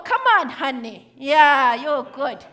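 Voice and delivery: ordinary talk, nothing marked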